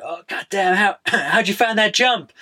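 a hint of a gushing American; American accent. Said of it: "one of the packers ... is like".

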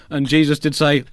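ordinary talk, nothing marked

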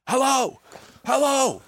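high, repeated sing-song hellos; mock excited hello. Young lads calling hello to a passing foreigner, high and eager. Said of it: "kept yelling hello".

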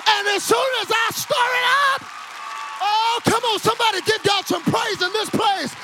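high, shouted revival preaching; fervent pulpit cry, pitched high; full-force preaching. Real, not acted. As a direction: build to a rousing shout and rally the congregation.

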